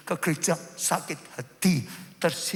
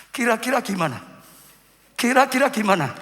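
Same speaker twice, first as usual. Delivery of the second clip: raised, high question to the crowd; challenging question; 6 semitones higher, 6 dB louder, faster than usual. Real, not acted. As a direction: throw the question out to the congregation. Challenging congregation after whisper demo. (pertanyaan lantang menantang jemaat)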